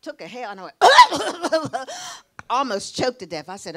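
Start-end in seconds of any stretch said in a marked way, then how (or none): 0.8-2.2 loud sputtering cough; comic coughing fit on a first drag; mock choking cough. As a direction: act out choking on smoke. Acts out choking on the inhale.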